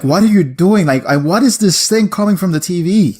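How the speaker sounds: a pet puzzling over the television, voiced high and loud; voicing a confused dog. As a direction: play the dog baffled by the TV noise. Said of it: "My dog was like".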